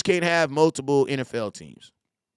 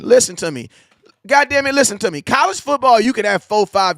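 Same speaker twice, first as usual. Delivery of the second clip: higher and louder, fired up to make the point; heated shout; 7 semitones higher, 8 dB louder than usual. Real, not acted. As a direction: shout it so nobody argues. Heated defense of college loyalties.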